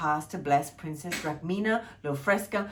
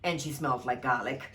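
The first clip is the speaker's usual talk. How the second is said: usual speaking voice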